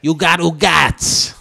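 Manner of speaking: put-on slow, drawn-out Jersey Italian accent; mock Italian-American accent. Teasing the troll in a slow Italian-American accent. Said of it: "Is that enough making fun of fucking Italians".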